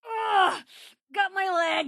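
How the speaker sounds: high, sharp yelp of pain; pain cry. Acted. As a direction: cry out as the leg is hit. Hit in the leg by an attack.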